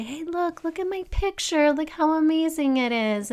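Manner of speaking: put-on high, eager little-kid voice showing off a drawing; a child begging a parent to look; excited kid. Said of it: "and we say".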